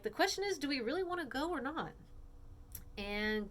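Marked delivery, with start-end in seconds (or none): 0.0-1.9 put-on high, pondering girl voice; Jen voice. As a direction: Jen asking, high-pitched. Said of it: "Jen's like".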